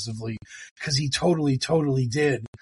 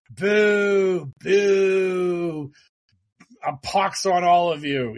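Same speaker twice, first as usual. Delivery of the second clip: higher, louder hammed-up booing; mock jeering boo; 4 semitones higher, 4 dB louder, much slower than usual. Imitating Joe M jeering at the public, mock boo. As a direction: boo the crowd like a sulky villain. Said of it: "And Joe M is just like".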